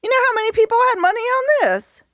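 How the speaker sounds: excited. Real, not acted. Gloating about winnings.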